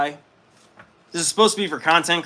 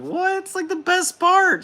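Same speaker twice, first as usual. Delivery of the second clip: squeaky high mock self, much higher; 4 dB louder than usual. Mocking own reaction to Maddie in a high squeak. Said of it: "And I'm like".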